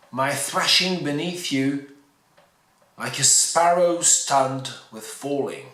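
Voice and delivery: slow, deliberate poem recitation; measured, spaced-out verse in English; poetry reading. Acted. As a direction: read the verse slowly, pausing between phrases. Reads the English poem aloud.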